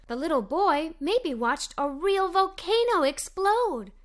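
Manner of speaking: usual speaking voice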